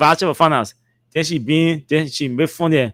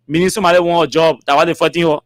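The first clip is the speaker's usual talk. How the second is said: ordinary delivery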